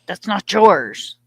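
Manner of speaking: drawn-out reproachful pet telling-off; mock scolding. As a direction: tell off a thieving dog, drawn out. Acted scolding of the dog.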